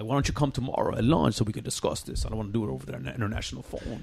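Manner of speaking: lightly voiced Scarface character. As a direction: drop low and quiet, a smooth boss. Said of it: "catch on to the other characters".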